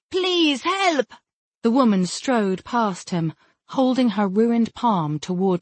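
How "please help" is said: high-pitched cry for help; shouted plea. A narrator's reading. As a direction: call out high, pleading for rescue.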